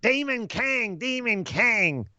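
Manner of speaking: high, slow mock wrestler chant; Seth Rollins. Host showing his wrestler impression, high and slow. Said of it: "that's my Seth Rollins impression".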